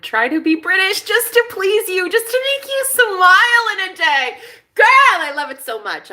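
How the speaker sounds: high-pitched, hammed-up British persona; British Jenna. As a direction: gush in a soaring put-on British accent. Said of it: "he knows that British Jenna".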